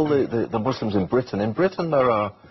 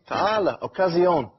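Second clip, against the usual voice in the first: lightly imitated, higher street-seller's call; a hint of a hawker's cry; nice vendor's voice; 5 semitones higher than usual. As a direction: beckon customers pleasantly, pitched up. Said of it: "with a nice voice".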